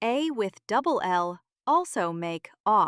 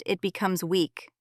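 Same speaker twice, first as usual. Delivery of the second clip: ordinary delivery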